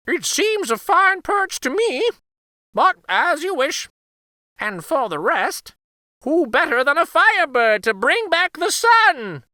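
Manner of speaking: very high, bright magical bird; Firebird voice. Acted. Firebird answers Katya.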